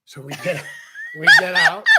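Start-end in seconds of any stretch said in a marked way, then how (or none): 0.9-1.8 laughing it out loud, high; cracking up mid-story. Real, not acted. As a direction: bursts of laughter through the words. Laughing while retelling hike.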